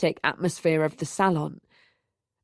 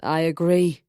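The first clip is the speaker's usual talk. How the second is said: put-on languid drunk; 3 semitones lower than usual. A narrator's reading. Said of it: "He nodded languidly".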